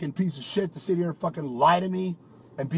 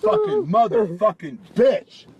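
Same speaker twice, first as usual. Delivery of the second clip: furious cursing; 4 semitones higher than usual. Real, not acted. Boyfriend cursing at girlfriend in fury. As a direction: spit the insult out in rage.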